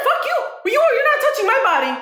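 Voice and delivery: high, fast comic outrage with a laugh; mock indignant refusal. Patient refusing a nurse poking for veins, mock indignant.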